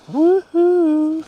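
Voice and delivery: high, loud joyful whoop; excited whoop. Real, not acted. Angler whooping at a bite on the line. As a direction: whoop it out like a fish just hit. Fish on, cheering to himself.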